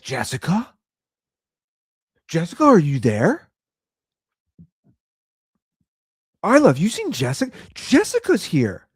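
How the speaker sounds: high, sing-song mock call, lightly voiced; mock calling for Jessica. Retelling how he calls Jessica to daughter, mock high sing-song call. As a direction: call out a made-up Jessica to distract the kid. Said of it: "I was like".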